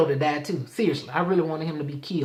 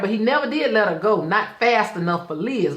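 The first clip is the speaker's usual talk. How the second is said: ordinary delivery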